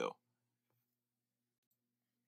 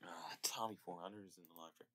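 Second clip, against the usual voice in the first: ordinary delivery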